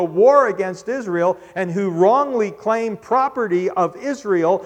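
loud, high preaching build; preaching at full force. Acted. Preacher urging the congregation, loud and rising. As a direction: proclaim it to the congregation.

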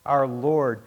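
normal talking voice

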